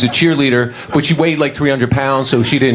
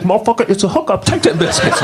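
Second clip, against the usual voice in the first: higher, sassy LaWanda pushing the biscuits; 9 semitones higher than usual. Retold or imitated. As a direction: hand over the hookup with sass. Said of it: "She's like".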